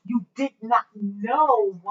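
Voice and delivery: each word "did not know" spaced and pressed slowly; slow stressed "You did not know". Real, not acted. Parent lecturing child, pressing each word slowly. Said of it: "Now watch".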